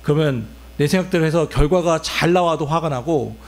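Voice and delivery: ordinary talk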